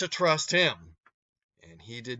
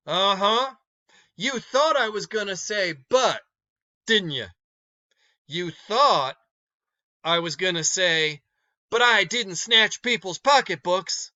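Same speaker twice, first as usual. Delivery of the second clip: knowing tease; 3 semitones higher, 5 dB louder than usual. A narrator's reading. Woman teasing the boy.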